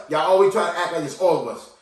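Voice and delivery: ordinary talk, nothing marked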